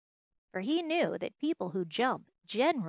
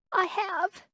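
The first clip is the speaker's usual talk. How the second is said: high, loud protest; crying out; 11 semitones higher, 5 dB louder than usual. Acted. Little rabbit insists to the wild rabbit, crying out. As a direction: cry it out in protest. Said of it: "cried the little rabbit".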